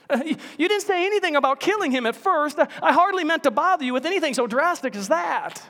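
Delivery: high, fast, flustered backpedal; nervous ghost. Acted. Ghost backing out of the angel's offer, high flustered voice. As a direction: talk your way out of it quickly.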